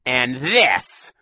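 menacing villain snarl; Count Olaf growl. A narrator's reading. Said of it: "Count Olaf growled".

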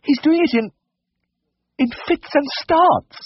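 very high, halting falsetto, slowing down; mortified lady. A narrator's reading. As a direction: force out the delicate words in a squeaky lady's voice. Embarrassed lady describes the pig.

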